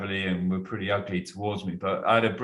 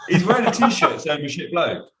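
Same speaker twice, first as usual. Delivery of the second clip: higher, louder, laughing through the words; can barely get it out for laughing; 8 semitones higher, 7 dB louder than usual. Real, not acted. Telling the podcast hosts about the oblivious fan, laughing hard.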